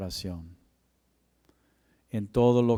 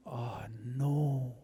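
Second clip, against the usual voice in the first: soft, sinking oh no; quiet groan of dismay; hushed dismay; 10 dB quieter than usual. Retold or imitated. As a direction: let the dread drop out of you. Dismay at the news.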